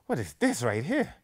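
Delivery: loud, slow, drawn-out disbelief; stretched out mock exasperation; exasperated disbelief. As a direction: act it like you can't believe it. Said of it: "I'm like".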